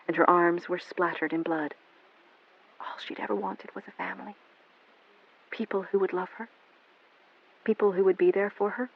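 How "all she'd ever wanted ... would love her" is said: much softer, near whisper; hushed narration. A narrator's reading. Narrator softly mourning Melanie's lost family. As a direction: let it fall to a hush. Sad closing reflection.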